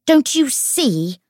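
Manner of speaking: high, loud, drawn-out rage; raging. A narrator's reading. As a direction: cry it slowly in fury. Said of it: "Tatiana raged".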